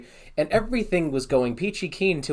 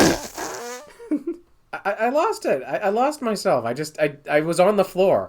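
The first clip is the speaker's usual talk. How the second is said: laughing; 6 semitones higher, 3 dB louder than usual. Real, not acted. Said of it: "lost his".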